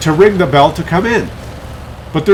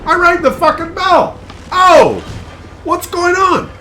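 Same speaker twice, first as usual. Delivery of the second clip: very high, indignant dog voice; freaking-out dog voice; slower than usual. Retold or imitated. Dog complaining to owner about being left outside, freaking out. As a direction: voice an outraged dog, pitched way up. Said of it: "He's, like, freaking out".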